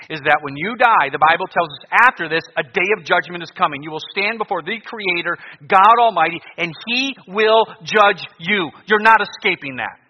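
high, loud fire-and-brimstone preaching; doomsday street preacher. Acted. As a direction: thunder out the warning of judgment.

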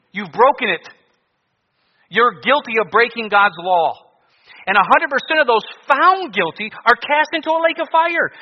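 high, loud fire-and-brimstone preaching; doomsday street preacher. Acted. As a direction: thunder out the warning of judgment.

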